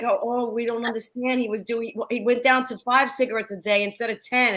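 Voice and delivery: mock relatives baffled about his smoking; mock bewildered relatives. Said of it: "They were like".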